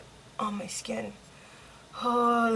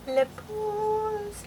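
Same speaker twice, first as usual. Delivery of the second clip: high calling voice; 12 semitones higher than usual. Real, not acted. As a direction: call the name out high. Calling out for someone.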